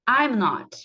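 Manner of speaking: I'M NOT with "I'm" run as one word; model pronunciation. Shown as a demonstration. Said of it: "Então, vamos lá". (pronúncia modelo da contração)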